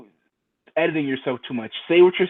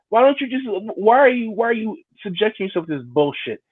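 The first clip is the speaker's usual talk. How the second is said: high-pitched, stumbling exasperation; exasperated questioning; 5 semitones higher than usual. Acted. Acting out confronting a miserable friend, exasperated. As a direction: demand an answer, tripping over restarts.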